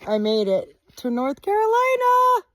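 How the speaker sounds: high, loud happy yell; excited shout. Real, not acted. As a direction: shout it out in triumph. State line celebration.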